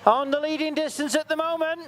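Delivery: excited play-by-play. Real, not acted. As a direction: call the pull as it happens, urgent. Live play-by-play of the pull.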